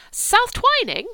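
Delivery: put-on a girl's loud, high, amazed cry of "South Twining?"; surprised exclamation. A narrator's reading. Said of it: "said Cordelia".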